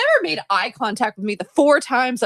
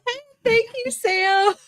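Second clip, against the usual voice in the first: laughing thanks; 12 semitones higher, slower than usual. Real, not acted.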